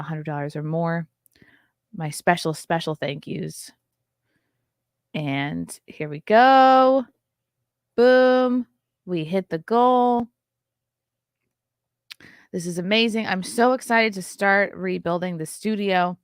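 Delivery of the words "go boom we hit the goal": loud, slow, building announcement; loud slow triumphant announcement; triumphant reveal. Real, not acted. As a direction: announce the big win. Announcing fundraiser fully funded.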